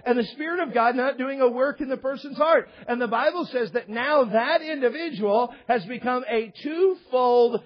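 impassioned pulpit indictment; forceful preaching. Real, not acted. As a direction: preach it with mounting force, slowing hard at the end.

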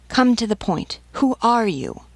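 impatient interrogation. A narrator's reading. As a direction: press her hard for an answer. Sergeant interrogates a fleeing woman.

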